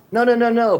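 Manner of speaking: high, loud mock protest repeating no; indignant protest. Owner pushing back at the consultant, indignant. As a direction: play the owner who won't hear it.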